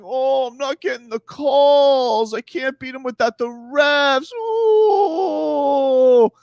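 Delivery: a hint of a whining player; mock moaning. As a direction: moan like a sore loser. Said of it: "complaining and moaning".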